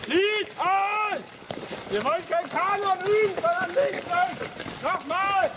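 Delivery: loud and high, scolding the singers; shouting. Real, not acted. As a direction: shout the order for another go.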